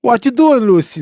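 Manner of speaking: put-on loud, very high, botched Cuban accent; Ricky Ricardo. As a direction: holler it in a badly faked Cuban accent. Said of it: "That's a horrible accent".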